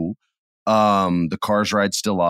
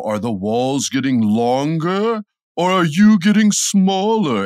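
slow, rising spooky announcer voice; spooky ride narrator; 6 semitones higher, 4 dB louder, slower than usual. Retold or imitated. Telling the Disneyland crowd the spooky ride intro, slow and eerie. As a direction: draw it out like a haunted host.

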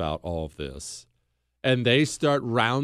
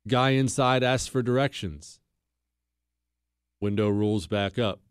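normal talking voice

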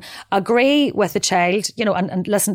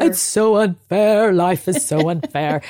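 slow, drawn-out mock whine; whining child; slower than usual. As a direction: mimic a sulky kid complaining.

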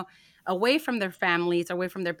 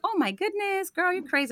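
amazed clerk; 12 semitones higher than usual. Retold or imitated. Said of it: "And she's like".

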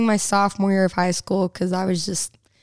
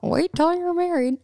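higher-pitched imitation of a nagging parent; mock parental tone; 8 semitones higher than usual. Singer mimicking the abstinence talk she never got, mock parental tone. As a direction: put on a high parental lecture voice. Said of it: "Like, in that tone?".

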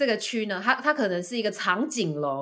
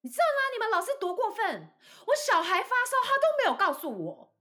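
much higher, whiny complaint; mock complaining mother. Mother complaining to the boss about the teacher, high whiny mock voice. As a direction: tattle to the boss in a high put-on voice. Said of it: "他就跟我老板说".

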